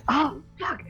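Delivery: normal talking voice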